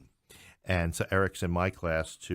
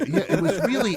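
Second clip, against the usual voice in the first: laughing; 13 semitones higher, 9 dB louder than usual. Real, not acted. Laughing about dusty professor.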